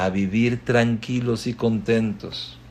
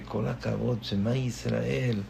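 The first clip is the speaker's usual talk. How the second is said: much softer, awed murmur; softly marvelling; awed hush; 9 dB quieter than usual. Retold or imitated. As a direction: breathe it out in quiet amazement.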